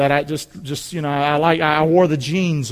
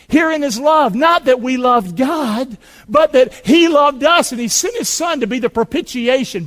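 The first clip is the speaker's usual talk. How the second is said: louder, higher, proclaiming each phrase; scripture preached aloud; 6 semitones higher, 5 dB louder than usual. Real, not acted. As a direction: ring the verse out like a preacher. Preacher reads scripture at full force.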